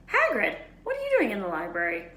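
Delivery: startled to spot him; surprised call. A narrator's reading. Ron calling out to Hagrid in the library.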